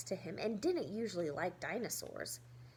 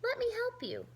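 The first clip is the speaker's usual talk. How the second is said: put-on eager kid voice; 13 semitones higher than usual. Felix offers help.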